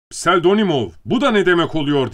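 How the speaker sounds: loud, high-pitched indignant call; shouted demand. A narrator's reading. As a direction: cry out for an explanation. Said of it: "diye bağırdı".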